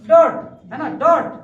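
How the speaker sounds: ordinary talk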